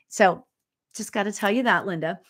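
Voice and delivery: ordinary talk, nothing marked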